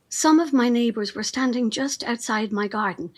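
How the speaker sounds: ordinary talk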